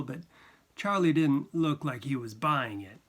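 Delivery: ordinary delivery, nothing marked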